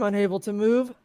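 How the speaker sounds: usual speaking voice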